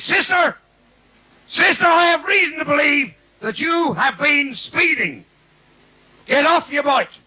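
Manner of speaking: high, loud put-on copper voice; mock policeman. Comic voicing a copper stopping a nun, loud and high. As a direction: play a pompous Irish bobby stopping a nun.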